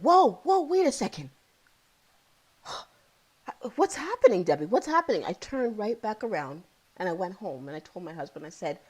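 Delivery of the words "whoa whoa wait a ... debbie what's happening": startled alarm. Retold or imitated. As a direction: catch yourself in shock.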